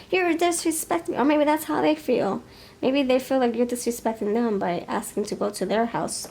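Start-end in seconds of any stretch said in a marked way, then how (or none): 0.1-1.2 high, mock-indignant reproach; mock offended. Listener scolding the date for booking a room, high mock offense. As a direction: play up the offense, pitched high. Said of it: "repeating the offended line".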